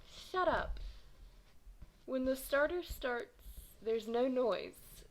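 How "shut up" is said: high, sharp angry snap; snarled. Acted. Bump turns on the crowd with an angry snarl. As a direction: shut the heckler down. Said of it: "Bump turned and snarled".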